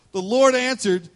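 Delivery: ordinary talk, nothing marked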